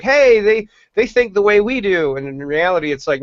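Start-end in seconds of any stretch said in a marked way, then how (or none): 0.0-2.2 higher-pitched imitation of eager followers; eager mock quote. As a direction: mimic easily won-over viewers, pitched up. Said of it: "because they're like".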